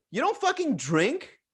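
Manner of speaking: high-pitched incredulous question; retold indignant challenge; imitating her outrage. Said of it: "she got really fucking mad".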